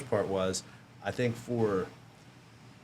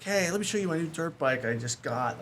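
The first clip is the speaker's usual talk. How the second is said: eager showing off; 8 semitones higher than usual. Retold or imitated. Eagerly showing off new bike.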